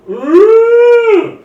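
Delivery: loud high monster roar; snail roar. Acted. Snail roars at the approaching druid, loud and high. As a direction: bellow it out like a startled beast. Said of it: "you hear the snail go".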